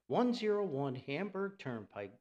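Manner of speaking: normal talking voice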